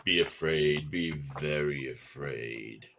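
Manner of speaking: low, quiet movie threat; low hushed horror warning; ominous warning. Retold or imitated. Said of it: "Like I said".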